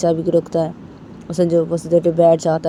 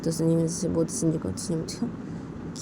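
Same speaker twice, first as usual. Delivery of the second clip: ordinary delivery, nothing marked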